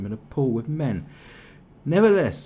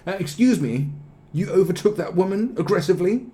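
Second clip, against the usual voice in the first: higher, louder fussy jobsworth; mock prissy complaint; 10 semitones higher, 8 dB louder than usual. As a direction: tut it like an indignant busybody. Mocks prissy complaint in pool.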